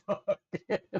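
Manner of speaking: high-pitched, laughing throwaway. Real, not acted. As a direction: crack up while saying it. Laughs at own charity idea.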